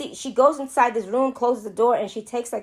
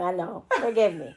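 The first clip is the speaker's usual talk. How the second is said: normal talking voice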